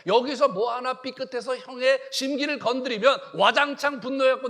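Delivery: loud, rising sermon delivery; fervent preacher pressing the congregation; preaching at full force. Acted. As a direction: drive the point home from the pulpit. Preacher building intensity.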